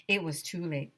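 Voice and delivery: ordinary delivery, nothing marked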